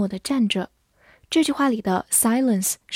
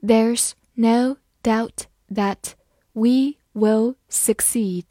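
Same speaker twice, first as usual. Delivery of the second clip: very slow, each word laid out clearly; slow read; much slower than usual. Shown as a demonstration. Teacher reading slowly to her students, slow and clear. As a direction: spell it out slowly for the learners. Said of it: "好，慢慢来读".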